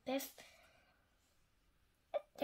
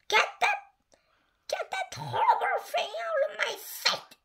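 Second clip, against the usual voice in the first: very high, squealed princess yell; high-pitched storybook outburst; 3 dB louder than usual. A narrator's reading. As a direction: shriek it as a disgusted princess. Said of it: "she yelled".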